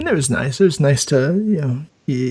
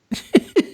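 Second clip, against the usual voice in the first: laugh. Real, not acted. Laughs at day two.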